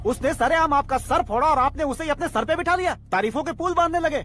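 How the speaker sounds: high-pitched, worked-up scolding; goading rebuke. Acted. Aide chiding a fooled boss, goading. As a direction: needle him in a high voice.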